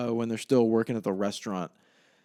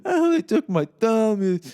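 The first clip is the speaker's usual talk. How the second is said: slow, high, loud mock wail; Paulie in pain; 11 semitones higher, 7 dB louder, slower than usual. Imitating Paulie collapsing into Charlie, pained angry wail. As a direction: stretch it out in pained, angry anguish. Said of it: "through all this pain and fucking anger".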